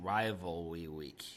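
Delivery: Rivalry week said as rivalwy week, slow and low; Rivalry with the second R as a W; says w for r. Real, not acted. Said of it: "'cause I can't say my R's".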